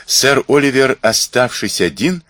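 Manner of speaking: usual speaking voice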